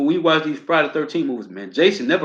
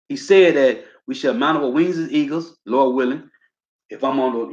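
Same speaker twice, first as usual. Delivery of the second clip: ordinary talk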